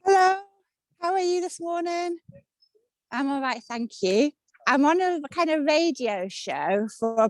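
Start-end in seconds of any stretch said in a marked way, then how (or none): 0.0-2.2 friendly greeting. Real, not acted. Greeting a child on the street.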